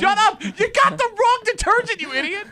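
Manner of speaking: high, loud hammed-up yelling; mock yell. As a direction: bark it like a fake tantrum.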